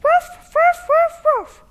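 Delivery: high yappy barks like a little dog; playful puppy barks; dog barking. Acted. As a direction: bark it out high and loud like a beagle. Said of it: "talking to a stray beagle".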